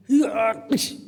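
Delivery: loud strained attack cry with impact noise; mimicked smackdown shout and crash; wrestling slam demo. Shown as a demonstration. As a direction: yell as if throwing an opponent down. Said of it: "Gini ya".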